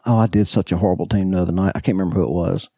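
normal talking voice

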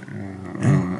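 ordinary talk, nothing marked

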